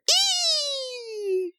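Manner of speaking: very high mock squeal; quick falsetto eek. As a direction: let out a tiny shocked squeal. Someone squeals in scene.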